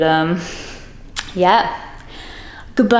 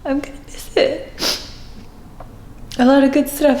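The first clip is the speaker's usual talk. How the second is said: crying; 4 semitones higher, 3 dB quieter than usual. Real, not acted. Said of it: "I'm sorry I'm crying".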